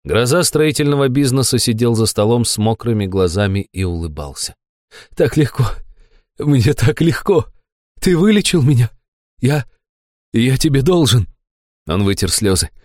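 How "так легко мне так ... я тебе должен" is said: high, fervent character voice; overjoyed gratitude. A narrator's reading. Cured fat man thanks his healer, overjoyed. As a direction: marvel in grateful ecstasy. Tearful converted man rejoices.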